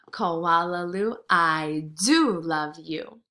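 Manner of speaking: slow, gentle loving mother; tender mother. Acted. Imagined mother speaking tenderly.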